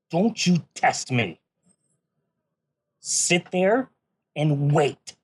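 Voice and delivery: loud menacing barbarian threat. Acted. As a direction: roar it like a brute warrior. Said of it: "threatening listeners to stay seated".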